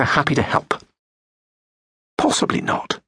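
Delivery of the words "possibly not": breathy under-the-breath put-down; dry whispered aside. Real, not acted. Narrator adds a sneering hushed afterthought. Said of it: "dry aside".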